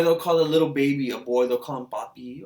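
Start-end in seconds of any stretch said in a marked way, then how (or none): none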